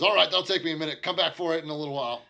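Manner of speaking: a barkeep telling a customer to come back later; tavern keeper voice. Acted.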